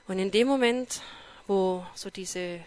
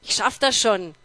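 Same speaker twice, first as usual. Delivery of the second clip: much louder, calling down the hall; confident call to the departing boss, louder; called after him; 11 dB louder than usual. Retold or imitated. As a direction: shout it after someone walking away. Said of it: "hab ich ihm hinterher gerufen".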